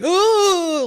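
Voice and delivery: hammed-up squeal of a coward; scaredy cat gasp. Mocking the cowardly sidekick with a high frightened oh. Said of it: "this stupid scaredy cat".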